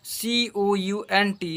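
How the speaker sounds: ordinary delivery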